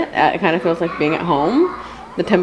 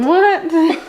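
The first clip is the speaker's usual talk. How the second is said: high squeaky mock shock; mock surprise; 10 semitones higher, 3 dB louder than usual. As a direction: pretend to be shocked.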